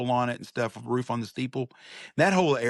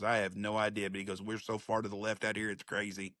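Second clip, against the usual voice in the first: much softer, lightly voiced old man; softly voiced old man; 2 semitones lower, 10 dB quieter than usual. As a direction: let the old man answer in a hush. Old Chinese man answering, retold.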